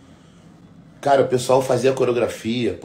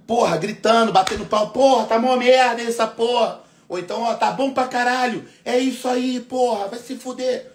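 1 rowdy fan heckling; 10 semitones higher than usual. Retold or imitated. Voicing rowdy fans in the crowd.

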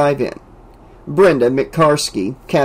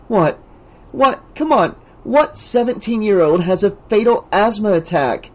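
higher, upset disbelief near tears; fighting tears; 7 semitones higher than usual. A narrator's reading. Debbie fighting tears with her friends. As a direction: hold back tears in anger. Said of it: "fighting tears".

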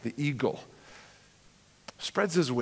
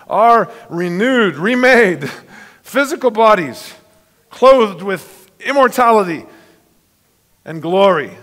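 louder, higher rising preacher's proclamation; fervent preaching; 6 semitones higher, 9 dB louder than usual. Acted. Preacher exulting to the congregation about Jesus' glorified body. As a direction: build the wonder of the risen body.

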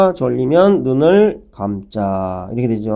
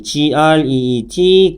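slow letter-by-letter spelling; slow teacher spelling; much slower than usual. Shown as a demonstration. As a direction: spell out P E E L one letter at a time. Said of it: "spelling the word out".

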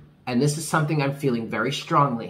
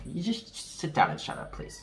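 hushed scolding; 5 semitones higher, 7 dB quieter than usual. Acted. Telling her ego to hush.

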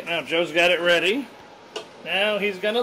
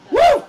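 whoop; 6 dB louder than usual. Real, not acted. Cheering the burrito fillings.

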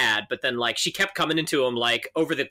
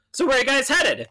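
chirpy cabbie; 10 semitones higher, 3 dB louder than usual. Retold or imitated. Mimicking chatty cab driver.

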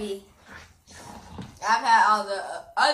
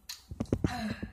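pained gasp; 9 dB quieter than usual. Real, not acted. Gasping after spicy bite.

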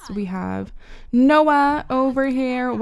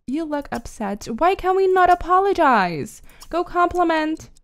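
normal talking voice

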